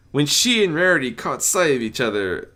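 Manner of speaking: ordinary delivery, nothing marked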